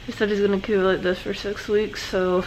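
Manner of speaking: talking through wired-shut teeth; talking with mouth closed. Real, not acted. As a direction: speak with jaw clenched shut. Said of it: "this time my mouth is wired shut".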